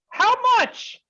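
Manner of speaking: high, small voice out of the dark; little voice from the darkness. Acted. Thief asks the peasant from the darkness, in a tiny high voice. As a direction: squeak it from the shadows. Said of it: "a little voice goes".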